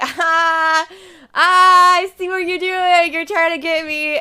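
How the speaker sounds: high, loud mock know-it-all; knowing disbelief. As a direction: call out their prank, amused and loud. Retold teasing disbelief.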